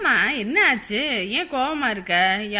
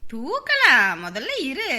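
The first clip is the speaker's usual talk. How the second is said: ordinary talk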